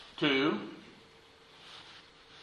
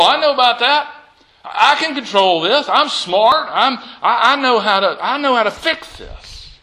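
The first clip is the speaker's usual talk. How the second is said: higher, louder cocky self-assured voice; proud Jairus; 8 semitones higher, 5 dB louder than usual. Acted. Preacher voicing Jairus boasting to himself, high and cocky. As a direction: play the proud man who thinks he's in control.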